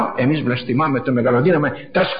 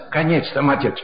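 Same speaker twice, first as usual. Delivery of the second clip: normal talking voice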